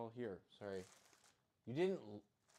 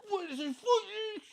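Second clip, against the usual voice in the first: much higher, louder cry of outrage; outraged Italian guy; 7 dB louder than usual. Retold or imitated. Imagined Italian guy scolding her pizza, outraged cry. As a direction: shriek like an offended Italian. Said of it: "some Italian guy ... going like".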